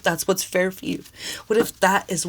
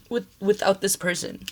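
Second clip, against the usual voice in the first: ordinary talk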